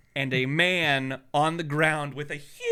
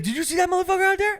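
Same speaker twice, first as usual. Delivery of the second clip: much higher and fast, blurting it out; worked-up clerk; much faster than usual. Retold or imitated. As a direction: rattle it off, too excited to stop. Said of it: "He's like".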